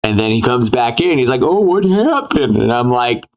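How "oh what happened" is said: innocent act. Retold or imitated. Voicing the returning reporter.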